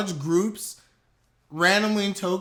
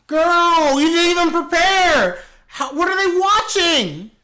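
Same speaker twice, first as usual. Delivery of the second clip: high, loud scolding of the screen; exasperated shout; 9 semitones higher, 5 dB louder than usual. Real, not acted. Calling out the streamer, loud and high. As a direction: yell at her in disbelief.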